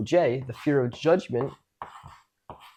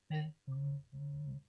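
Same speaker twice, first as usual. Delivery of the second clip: much softer, mumbled grunts; withdrawn mumble; 15 dB quieter than usual. Shown as a demonstration. Speaker shows a scale of expression, muttering softly. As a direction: barely make a sound, shut down. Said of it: "I can be".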